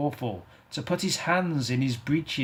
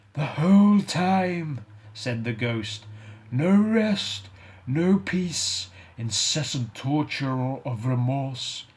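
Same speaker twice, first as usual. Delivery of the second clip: mournful ghost; 2 semitones higher than usual. Acted. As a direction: grieve for the wasted life. Ghost describing its torment.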